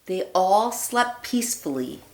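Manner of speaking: put-on lively read-aloud voice for children; storybook reading. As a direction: read it like a bedtime story for little ones. Reads picture book aloud.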